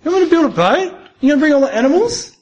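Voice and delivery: lightly acted Noah, astonished; Noah's disbelief. Noah questioning God's plan, high incredulous voice. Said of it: "Noah might have been saying".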